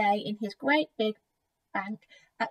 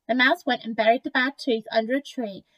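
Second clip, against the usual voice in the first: usual speaking voice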